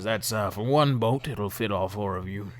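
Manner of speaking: lightly voiced tipsy boatman; a hint of boozy boatman; drunk middle-aged man. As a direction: explain it as a mild drunk. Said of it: "drunk middle-aged man".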